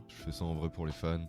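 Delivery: usual speaking voice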